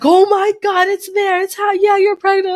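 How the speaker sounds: high, excited retelling of dad; hammed-up amazed exclamation; imitated astonishment. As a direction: burst out high and loud, then keep it up. Dad's reaction on seeing the test.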